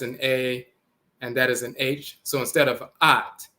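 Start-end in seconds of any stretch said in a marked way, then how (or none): none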